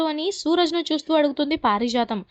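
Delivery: ordinary delivery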